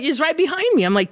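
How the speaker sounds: ordinary talk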